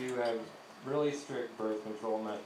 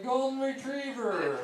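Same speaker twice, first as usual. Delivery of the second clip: dumb answer; 12 semitones higher, 5 dB louder, slower than usual. Retold or imitated.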